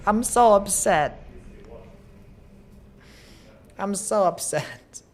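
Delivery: mock-sulky whine after a failed run; drawn-out whine. Real, not acted. Streamer whining over failed run.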